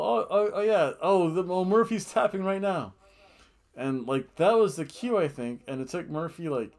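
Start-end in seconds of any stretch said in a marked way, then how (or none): 0.0-2.9 loud, high, flustered commentator imitation; imitating an excited wrestling commentator; Michael Cole. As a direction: blurt out a mock play-by-play call. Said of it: "and you just hear Michael Cole".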